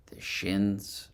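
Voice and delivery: guided meditation. Acted. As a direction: gently lead them through a body scan, phrase by phrase. Leads a breathing and body scan meditation.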